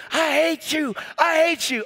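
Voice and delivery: high, acted hateful cry; angry outburst. Angry people lashing out at a believer, high-pitched mock rage. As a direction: throw it out like someone lashing out. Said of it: "People are angry".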